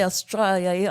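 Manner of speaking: broad Aussie twang on Australia; Australian accent. Shown as a demonstration. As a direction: say Australia like a local. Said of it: "I promise not to do the accent again".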